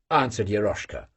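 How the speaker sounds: plain reading voice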